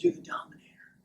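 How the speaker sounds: hushed creeper whisper. Acted. Creeper recognizing the coach, whispered creeper voice. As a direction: whisper it like a creep up too close. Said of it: "this creeper comes up behind me".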